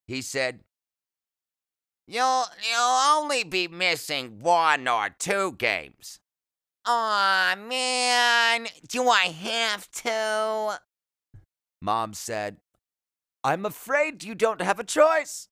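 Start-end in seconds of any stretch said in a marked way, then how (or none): 2.1-6.2 high, loud, slow doctor character; doctor's voice. A narrator's reading. Doctor telling a boy about his operation, high loud character voice. 6.8-10.8 very high, loud, drawn-out whine; whining boy. A narrator's reading. Boy objecting to surgery before baseball ends, high whiny protest. 13.4-15.5 high, loud mother character; mom's voice. A narrator's reading. Mother overruling her son about the operation, high loud voice.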